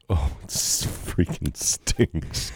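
hushed, slow, disgusted; disgusted whisper. Real, not acted. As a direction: breathe it out in disgust. Wine tastes of cologne.

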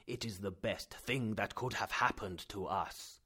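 low, slow, content; calm delight. Acted. Uncle pleased.

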